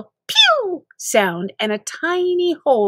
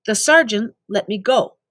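ordinary delivery, nothing marked

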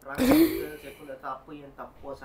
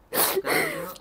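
sharp high outburst; 10 dB louder than usual. Acted. Heated argument.

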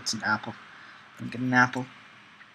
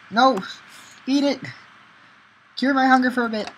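much higher, loud excited shouting; frantic yell; 12 semitones higher, 5 dB louder than usual. Real, not acted. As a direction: yell desperately at the food. Frantic about hunger in game.

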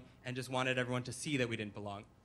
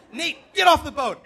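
high, very loud yell; freaking-out dad; 11 semitones higher, 14 dB louder than usual. Retold or imitated. Dad ordering son overboard, panicked bellow. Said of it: "my dad is freaking out".

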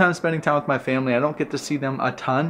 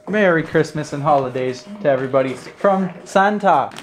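usual speaking voice

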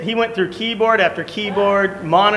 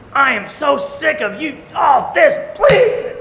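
going off; 8 semitones higher, slower than usual. Retold or imitated. Preacher retells losing his temper at work, shouting. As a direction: lose it at the coworker. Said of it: "I went off".

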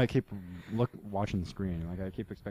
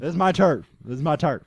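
louder, higher turf claim; mock tough guy; 7 semitones higher, 13 dB louder than usual. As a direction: bark it like a gang boss. Claiming territory.

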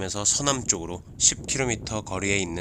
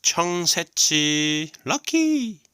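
high, loud, very slow reveal; dramatic announcement; 8 semitones higher, 5 dB louder, much slower than usual. Pretend. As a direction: announce the star of the day.

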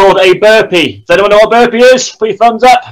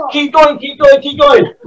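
cheering on; 5 semitones higher than usual. Real, not acted. Cheering on burpees.

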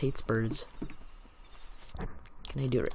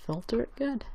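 goofy high; 10 semitones higher, 4 dB quieter than usual. Pretend. Playful high goofy line.

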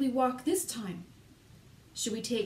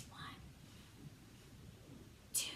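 ordinary talk, nothing marked

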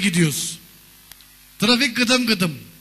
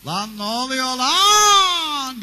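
very slow, siren-like drawn-out wail; police car yelling; 4 semitones higher, much slower than usual. Pretend. Police car shouting at the crash, siren wail. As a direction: stretch it like a wailing siren. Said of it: "Polis arabası bağırıyor oradan".

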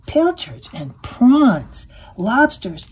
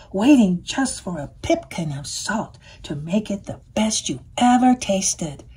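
plain reading voice

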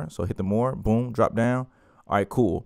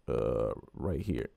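quiet aside; 9 semitones lower, 10 dB quieter, much slower than usual. Real, not acted. Muttered aside while dragging.

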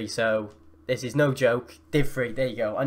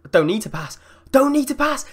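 louder and higher on the repeat; building excitement; 11 semitones higher, 6 dB louder than usual. Real, not acted. Gamer running through on goal, excitement building. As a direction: climb from raised voice to high shout.

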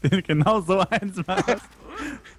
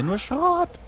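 high whiny complaint; 12 semitones higher than usual. Pretend. Mock whine about loot.

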